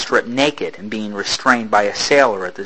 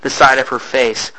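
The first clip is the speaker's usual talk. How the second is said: usual speaking voice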